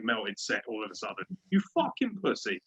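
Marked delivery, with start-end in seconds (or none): none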